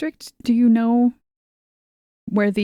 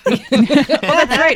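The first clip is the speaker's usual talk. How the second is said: laughing; 7 semitones higher, 6 dB louder, much slower than usual. Real, not acted. Laughing at the pants joke.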